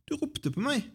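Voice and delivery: put-on high-pitched boy's reply; eager boy answering. A narrator's reading. Samuel tells Eli why he came, eager reply. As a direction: lift it high as young Samuel.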